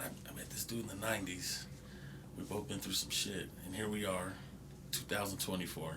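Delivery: soft whispered musing to himself; quiet unvoiced recollection; hushed inner thought. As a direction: breathe it like a private thought. Recalled thought while listening to beats.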